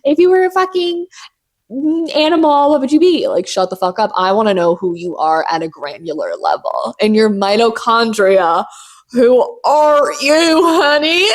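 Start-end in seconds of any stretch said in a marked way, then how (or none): 0.0-3.3 high, mocking voice for a silly question; lightly mocking high voice. Parodying shallow questions. 9.1-11.3 slow, high, teasing voice; high sassy drawl; playful probing. Pretend. Probing question, drawn out.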